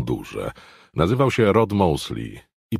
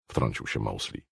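ordinary delivery

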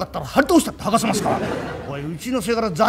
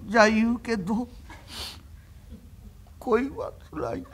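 bashful confession; 8 dB quieter than usual. Acted. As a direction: confess shyly in a fading voice. Young master confesses love.

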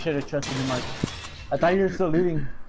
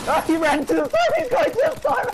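excited yell; 5 dB louder than usual. Real, not acted. Teammate runs into storm.

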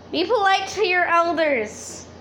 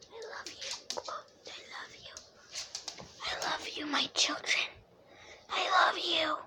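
very soft, breath-only endearments; affectionate whisper; 3 semitones higher, 13 dB quieter than usual. Real, not acted. Owner to "my children", affectionate whisper. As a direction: whisper it fondly, barely audible. Tender words to pets.

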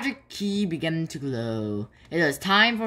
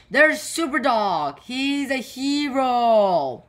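excited crowd cheer; 8 semitones higher, 4 dB louder, slower than usual. Acted. People cheering Floppy in street.

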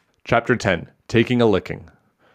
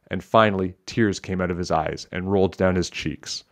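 plain reading voice

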